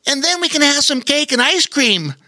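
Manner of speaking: put-on high eager kid voice, blurted; blurting Bobo Chico. A narrator's reading. Bobo Chico blurting about cake, high and eager. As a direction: jump in eagerly like an excited child. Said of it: "blurted out Bobo Chico".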